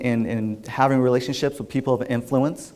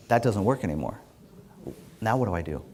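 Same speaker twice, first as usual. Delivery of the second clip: understated bewilderment, trailing lower and softer; retold dumbfounded; 4 semitones lower, 5 dB quieter, faster than usual. Client whose lifelong approach stopped working, mildly dumbfounded. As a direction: imitate someone quietly at a loss. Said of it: "She was completely dumbfounded".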